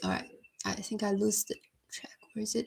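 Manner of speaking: whispered to himself while searching; breathy half-voiced self-talk, barely audible. Real, not acted. Lost in the chat, whispers to himself while scrolling.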